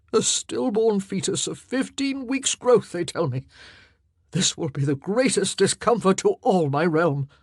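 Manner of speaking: a lightly voiced king in agony; grieving. A narrator's reading. King laments the lost son, grieving.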